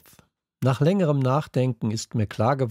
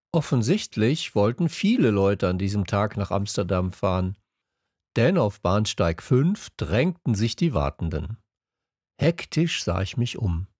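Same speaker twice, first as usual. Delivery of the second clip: ordinary delivery, nothing marked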